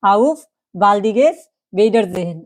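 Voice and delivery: slow, careful teacher model of the phrase; slow German demo. Shown as a demonstration. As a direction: say it slowly for beginners. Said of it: "এটা কি".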